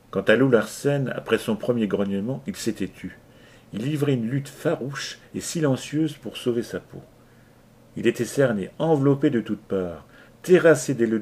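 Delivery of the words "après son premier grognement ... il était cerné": ordinary delivery, nothing marked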